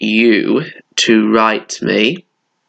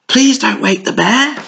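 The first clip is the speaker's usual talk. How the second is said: higher, pleading little voice; pleading animals; 11 semitones higher than usual. Acted. Animals pleading not to wake the bear: higher, pleading voice. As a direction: beg them not to wake him.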